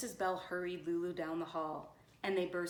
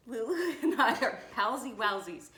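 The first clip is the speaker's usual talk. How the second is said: higher, louder, giggling mid-line; real laugh breaking through; 5 semitones higher, 8 dB louder than usual. Real, not acted. Reader cracks up at the nurse's silly words, laughing. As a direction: try not to laugh while reading.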